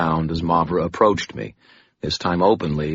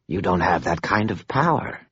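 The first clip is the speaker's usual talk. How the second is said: dusty voice. Acted. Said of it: "she said in her dusty voice".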